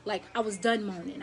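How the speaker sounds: ordinary talk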